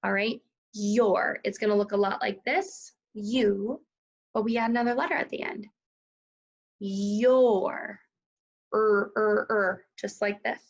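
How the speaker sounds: ordinary delivery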